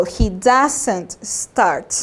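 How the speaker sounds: he doesn't start spoken very slowly, word by word; slow model sentence. Shown as a demonstration. Teacher modelling for Spanish-speaking students, very slow. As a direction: spell it out slowly for the class. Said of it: "For example".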